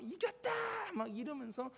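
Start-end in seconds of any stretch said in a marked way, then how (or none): 0.0-0.5 high, hushed mock cheer; crowd cheering victory. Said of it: "막 환호성이 막 있지 않겠습니까". (작고 높은 소리로 흉내 낸 환호성)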